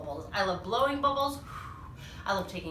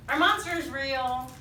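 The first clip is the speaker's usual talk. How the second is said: ordinary delivery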